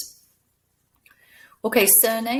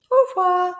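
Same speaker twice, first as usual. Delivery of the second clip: much higher, loud squeaky farewell; high puppet goodbye; 11 semitones higher, 9 dB louder than usual. Acted. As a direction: wave goodbye as the toy.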